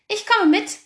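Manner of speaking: spring up and insist; eager fox. A narrator's reading. Fox jumping up to join them, high and eager.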